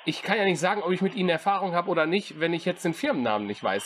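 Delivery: normal talking voice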